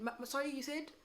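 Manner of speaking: high, puzzled "sorry, you said?"; mock confused asking again; confused customer. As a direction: play a customer who lost the thread. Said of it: "they look at you like".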